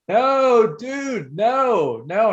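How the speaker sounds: high, loud panicked yell; panicked shout. Retold or imitated. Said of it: "I'm saying it out loud".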